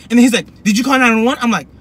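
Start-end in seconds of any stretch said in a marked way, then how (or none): 0.6-1.4 raised, louder interrogating voice; mock screaming cop. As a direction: imitate a cop yelling in someone's face. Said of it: "he's screaming at me".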